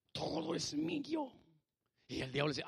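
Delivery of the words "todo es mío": drawn-out high claim; soft-edged high, slow affirmation; high, slow declaration. Acted. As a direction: lift the voice high and stretch each word. Preacher repeats claim, higher take.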